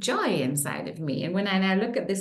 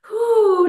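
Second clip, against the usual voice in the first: loud whew; 12 semitones higher, 10 dB louder than usual. Real, not acted. Exhale after affirmation.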